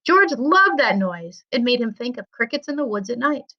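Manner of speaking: ordinary delivery, nothing marked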